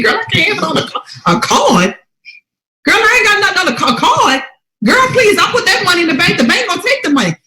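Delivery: high, fast protest; exasperated friend talk. Retold or imitated. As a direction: stumble over the word in a rush. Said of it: "And I said".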